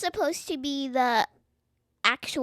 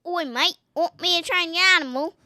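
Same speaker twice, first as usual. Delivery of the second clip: put-on slightly raised, quick Australian accent; 3 semitones higher, 3 dB louder, faster than usual. Child demonstrating accents for dad, Australian. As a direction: put on a playful Aussie accent. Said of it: "I think I could do Australian".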